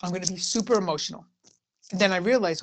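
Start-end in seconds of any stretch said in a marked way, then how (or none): none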